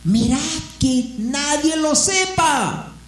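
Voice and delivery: slow, high, half-laughing mock shout; a hammed-up strict order; Jesus strictly warning the healed men, mock shouted; stern warning. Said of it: "les encargó rigurosamente".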